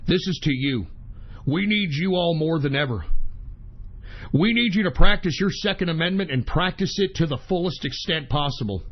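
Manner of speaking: host toasting his listeners, low and sincere; solemn toast. Acted. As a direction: raise a glass in grave sincerity.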